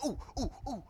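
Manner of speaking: short, separate "ooh" yelps of tender feet; mock pained oohs. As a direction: imitate hopping barefoot over sharp stones. Retold walking barefoot on rough ground.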